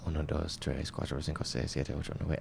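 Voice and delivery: soft, low counting in Spanish, number by number; slow counting in Spanish. Retold or imitated. Counting in Spanish to the cashier, softly. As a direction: count it out in Spanish under your breath. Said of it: "I was going".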